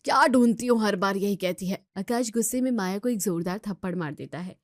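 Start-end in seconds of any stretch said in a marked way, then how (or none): 0.0-1.9 loud, irritated bark at the wife; loud angry snap. Acted. Husband scolding his wife over the lost file, loud and angry.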